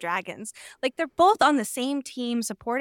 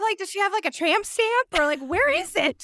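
giddy high question; 8 semitones higher than usual. Real, not acted. Co-host gushing to her friend, high and giddy. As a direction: squeal the question with delight. Giddy speculation about relic location.

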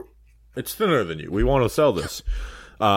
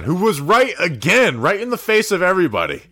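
big, high, loud announcer hype; hyped-up introduction; 8 semitones higher, 6 dB louder than usual. Real, not acted. Host hyping the weatherman's return to the show, high and loud. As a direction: proclaim it high and loud, stretching it out.

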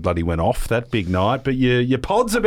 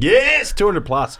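intro shout; 10 semitones higher, 4 dB louder, slower than usual. Shown as a demonstration. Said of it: "a bit like".

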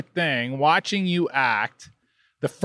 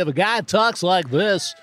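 announcer voice; 3 semitones higher than usual. Retold or imitated. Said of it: "sounds exactly like Oliver sounds when he tries to imitate me".

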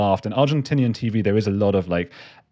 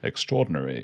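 put-on mid-Atlantic accent; 5 semitones lower, 6 dB quieter than usual. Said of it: "a slightly mid-Atlantic accent".

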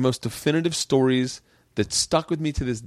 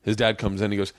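ordinary talk

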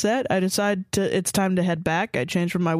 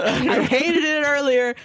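shout; 6 semitones higher, slower than usual. Real, not acted. Loud excited exclaim.

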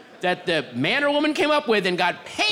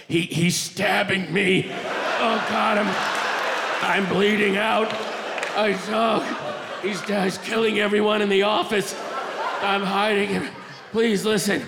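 hammed-up desperate begging in broken phrases; dying caller. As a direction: gasp it out as if bleeding to death. Acted dying telemarketer begging listener.

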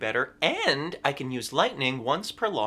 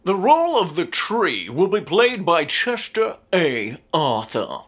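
announcer voice; 4 semitones higher, 7 dB louder than usual. Acted. School play announcer voice.

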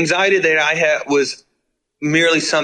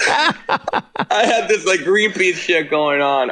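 laughing; 8 semitones higher, slower than usual. Retold or imitated. Repeating [name]'s line laughing.

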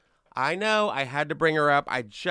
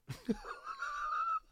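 shrieking laugh; 14 dB quieter than usual. Real, not acted. Host cracks up at quote.

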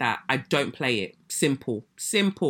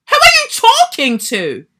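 much higher, much louder furious cry; outraged shout; 9 dB louder than usual. Real, not acted. Yelling at the nursery owner over her email, outraged. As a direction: blast it out, fully affronted. Repeats it even harder.

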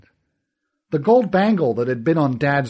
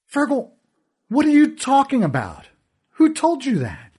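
much higher and louder, pleading; desperate bewilderment; 12 semitones higher, 5 dB louder than usual. Acted. Narrator begs Fergal to explain, high desperate alarm. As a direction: demand an answer in alarm.

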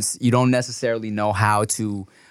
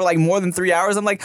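ordinary talk, nothing marked

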